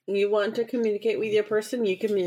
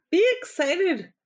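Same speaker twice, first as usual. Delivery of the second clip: excited; 9 semitones higher than usual. Real, not acted. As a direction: cheer her on, be excited. Urging friend to be excited.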